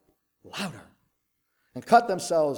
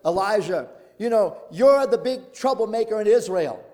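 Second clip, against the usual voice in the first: accusing king; 4 semitones higher, 8 dB louder than usual. Retold or imitated. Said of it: "Ahab says".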